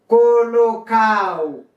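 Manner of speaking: colocado stretched as "co-lo-CAO", no D; "colocado" with a silent D; dropped D. Shown as a demonstration. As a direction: say colocado slowly, sliding from A into O and falling. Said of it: "ou qu'à prononcer ou qu'à pas".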